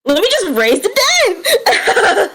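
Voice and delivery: high, drawn-out laughing mock boast; mock showing off. Player joking about the queen's spell, gleeful mock bravado. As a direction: sing out the boast high and slow. Mocks the queen casting raise dead.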